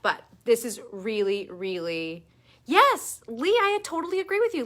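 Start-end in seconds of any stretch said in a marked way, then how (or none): 2.7-4.6 excited. Real, not acted. Excited reply to a viewer comment.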